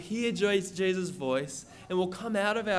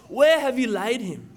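raised, louder voice quoting an angry question; imitated shout; 4 semitones higher, 6 dB louder than usual. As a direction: call it out forcefully, imitating a shout. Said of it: "so he probably shouts".

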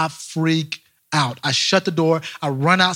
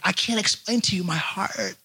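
softer, choked-up parent, imitated; distraught parent; 6 dB quieter than usual. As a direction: act lost for words with emotion. Imitating parents who lost a child.

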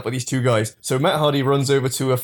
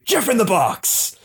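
higher wrestler battle cry; mock yell; 7 semitones higher than usual. Jeff bursting from the dumpster, hammed-up yell. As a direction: leap out yelling it. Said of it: "Jeff leaps out yelling".

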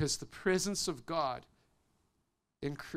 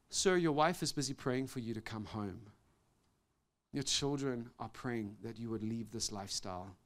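soft, low earnest appeal; gentle plea; 6 semitones lower, 6 dB quieter than usual. Retold or imitated. Pastor leaning into car window, soft earnest plea. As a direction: lean in and plead quietly.

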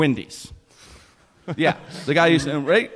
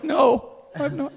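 wife voice; 12 semitones higher, slower than usual. Retold or imitated. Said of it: "that voice".

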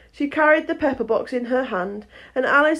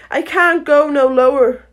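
higher, loud character voice; protesting Hatter; 5 semitones higher, 8 dB louder than usual. Acted. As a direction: plead back in a raised voice.

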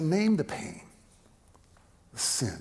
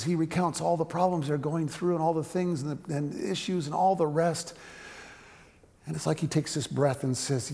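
usual speaking voice

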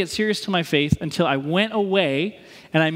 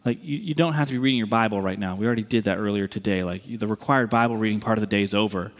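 low, casual aside to a buddy; imitated helpful younger self, pitched down; helpful advice; 9 semitones lower than usual. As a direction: gently let a friend off the hook. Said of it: "I just wanted to be helpful".

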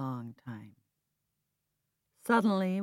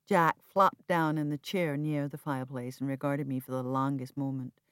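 ordinary delivery

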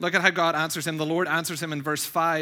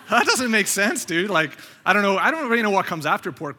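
loud, raised, comic disbelief; baffled protest; 5 semitones higher, 6 dB louder than usual. Retold or imitated. Host objecting to contestant's nonsense answer, baffled.

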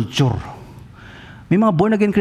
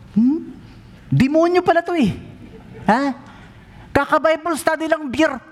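high, startled mock outrage; mock shock; 11 semitones higher than usual. Pastor reacts to the beer request, mock shocked. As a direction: gasp it out like you can't believe it.

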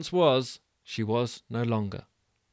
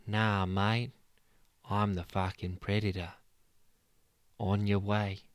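cool dismissal; 3 semitones lower than usual. Acted. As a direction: calmly send the bear off. Mudguard dismisses the bear.

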